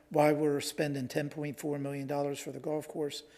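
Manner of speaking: ordinary talk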